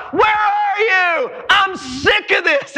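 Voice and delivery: high, drawn-out angry shout; exasperated yell, words stretched out; David yelling. Retold or imitated. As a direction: cry out to God, fed up. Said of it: "And he yells".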